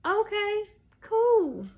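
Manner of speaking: high-pitched, agreeable reply; high little okay; retold easy agreement. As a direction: pitch it up, going along with it. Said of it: "I'm like".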